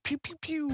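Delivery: high squeaky zap noises; pew pew sound effect. Acted. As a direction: fire off each missile with a squeaky pew. Mage firing magic missiles.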